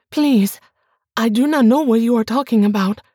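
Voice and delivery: frightened pleading wife. Acted. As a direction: rush the denial out in panic. Bianca pleads in fear.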